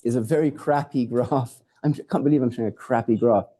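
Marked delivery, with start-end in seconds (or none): none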